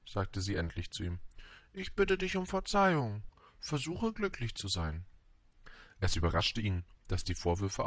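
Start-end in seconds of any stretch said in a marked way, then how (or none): 1.7-5.1 high, penitent storybook flower; proud flower, apologetic. A narrator's reading. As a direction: ask forgiveness high, then ease down.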